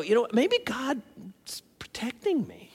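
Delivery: slow breathy whisper, as if confiding; quiet hopeful realization. Retold or imitated. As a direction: whisper it slowly to yourself. Quoting a hopeful inner thought.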